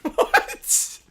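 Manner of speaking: squeaky high disbelieving what; shocked what. Real, not acted. Streamer hearing the worst anthem, stunned high what. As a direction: gasp it out in disbelief.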